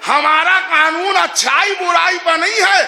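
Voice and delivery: loud, high and slow, pounding each phrase; fiery preaching. Acted. Preacher to congregation, driving home his point at full force. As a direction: thunder it from the pulpit.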